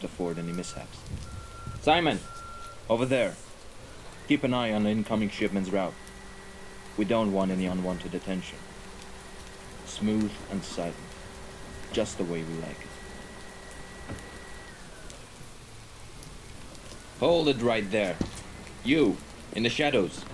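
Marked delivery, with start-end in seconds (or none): 1.8-5.9 raised, higher-pitched call to a worker. Acted. Calling out to a worker. 17.2-20.2 raised, higher voice confronting an intruder; loud, demanding interrogation; sharp challenge. Acted.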